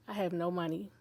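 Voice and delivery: low, subdued retelling of hopelessness; retold despair. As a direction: say it softly and low, quietly defeated. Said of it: "I was just crying".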